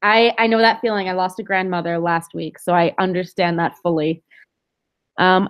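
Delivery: normal talking voice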